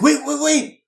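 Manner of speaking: high urgent cry of wait; alarmed shout. Acted. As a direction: yell to stop them touching it. GM voices NPC stopping touch.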